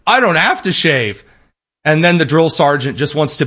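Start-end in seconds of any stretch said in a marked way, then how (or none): 0.1-1.2 high, whiny put-on excuse; hammed-up fake claim; mock recruit. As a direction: play the recruit dodging the rule, pitched up. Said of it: "so he tells the army".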